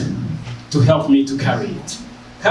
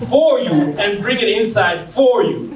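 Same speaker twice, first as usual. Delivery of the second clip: higher-pitched, exasperated telling-off; playful officer's lecture, pitched up; mock scolding; 7 semitones higher than usual. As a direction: scold him in amused disbelief. Officer scolds him in retold scene.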